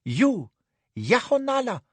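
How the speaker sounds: passionate reproach. Acted. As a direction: throw her honor at her. Anne passionate.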